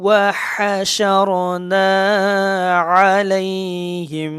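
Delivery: slow, drawn-out chanted Quran recitation, held long on each vowel; teacher chants the verse slowly for the students, in tajweed recitation style. Shown as a demonstration.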